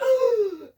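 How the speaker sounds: big high mock gasp of shock; loud shocked gasp. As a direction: gasp as a stunned crowd. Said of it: "And everyone's like".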